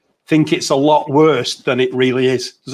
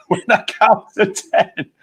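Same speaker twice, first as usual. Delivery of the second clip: slow, high, breaking into giggles; laughing; 5 semitones higher than usual. Real, not acted. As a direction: laugh while telling it. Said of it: "it's happening to me now".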